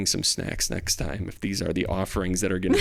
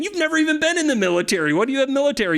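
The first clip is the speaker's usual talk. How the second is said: teasing; 3 dB louder, much faster than usual. Real, not acted. Teasing friend about military time.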